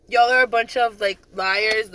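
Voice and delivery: retold scolding. Retold scolding of lying privates.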